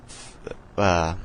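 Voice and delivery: tripping over the word; stuttering. Real, not acted. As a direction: stumble on the start, then hesitate. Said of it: "my bad, I'm stuttering".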